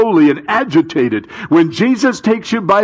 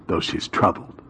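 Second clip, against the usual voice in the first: softer, whispered aside; whispered, tender aside; hushed whisper; 10 semitones lower, 9 dB quieter than usual. Acted. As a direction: drop to a breath on it.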